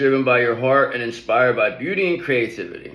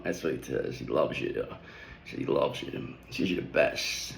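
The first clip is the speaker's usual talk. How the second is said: soft murmur; 11 semitones lower, 10 dB quieter than usual. Real, not acted. Relaying what the cat says.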